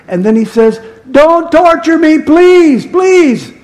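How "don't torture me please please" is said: high, loud, drawn-out begging; imitated pleading shout. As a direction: cry out slowly like a tormented man begging for mercy. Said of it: "he's shouting at Jesus".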